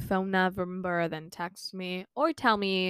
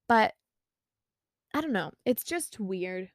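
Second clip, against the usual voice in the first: ordinary delivery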